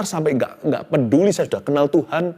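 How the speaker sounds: normal talking voice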